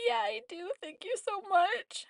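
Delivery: high, whispery tearful squeak; imitated weepy thanks. Patient thanking nurse for water, imitated weepy voice. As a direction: act out grateful thanks through tears, very high.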